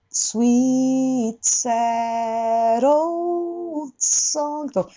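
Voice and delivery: put-on sweet sad old song drawn out and joined; slow, no gaps between the words; slow linked demo. Shown as a demonstration. Teacher showing students how to link the words. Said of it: "隙間を作らずに".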